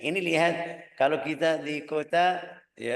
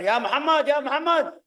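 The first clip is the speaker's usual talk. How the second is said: high, booming call; big loud voice; 13 semitones higher, 7 dB louder than usual. Acted. Bedouin calling out to the Prophet, big high shout. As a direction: holler the name at full voice. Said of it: "Jahwari itu besar".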